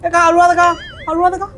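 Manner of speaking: high, loud panicked pleading; frightened shouting. Real, not acted. Gamer hears a ghost woman crying and pleads with her, frightened. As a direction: shout in real fright, begging her not to cry.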